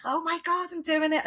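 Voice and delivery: put-on high, thrilled cry of a kid on a bike; excited child. Child on the bike, delighted and high. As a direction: squeal it like a child riding alone. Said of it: "they're like".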